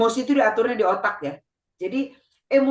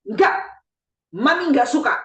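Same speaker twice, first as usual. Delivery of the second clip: loud and higher firm scolding; angry mother; 4 semitones higher, 3 dB louder, faster than usual. Shown as a demonstration. Mother to child, a loud angry no. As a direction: scold firmly, showing anger. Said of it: "Kita marah".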